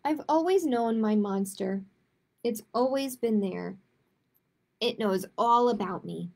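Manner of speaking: normal talking voice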